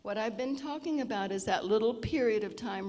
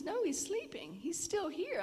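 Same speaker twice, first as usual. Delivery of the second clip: put-on higher, light child voice; a child explaining that granddad is asleep, voiced higher; child's voice; 10 semitones higher than usual. Child answers about grandfather.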